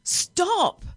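high, loud shout; yelling. A narrator's reading. Passengers on a runaway bus, yelling at driver. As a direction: yell it in alarm. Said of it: "yelled the passengers".

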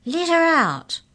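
high, loud demand; shout. A narrator's reading. Arthur shouting at the giants to free Mallory. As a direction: yell it at the giants. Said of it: "shouted Arthur".

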